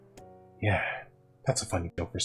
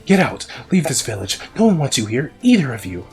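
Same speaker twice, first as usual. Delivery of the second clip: furious shout; 6 semitones higher, 10 dB louder than usual. Acted. Boy shouting threats.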